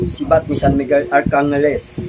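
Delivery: low, slow, measured incantation; solemn invocation. Real, not acted. Healer calling on the archangel's spear, solemn invocation. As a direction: intone it slowly and deep. Healer invoking a saint's weapon.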